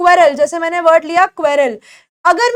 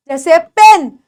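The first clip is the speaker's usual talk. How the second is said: usual speaking voice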